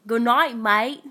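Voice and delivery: lightly put-on Australian twang; mock Australian accent. Sign-off after joking about accents.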